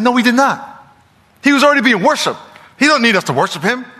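high-pitched, emphatic rebuttal; heated argument. Retold or imitated. Preacher retelling his dispute over man's purpose, heated objection. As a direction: argue back hard. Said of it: "heated, almost argument".

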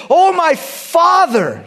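high, loud, drawn-out call; big acted cry, stretched long. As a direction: cry out to him in anguish, slowly. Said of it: "David cries out to Saul".

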